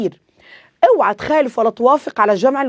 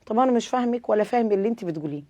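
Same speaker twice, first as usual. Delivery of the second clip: a hint of a lost student, fast and softer; confused student; 8 dB quieter, faster than usual. Teacher voicing a confused student in class, mildly softer and fast. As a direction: rattle it off quietly like a baffled pupil. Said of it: "confused student voiced by teacher".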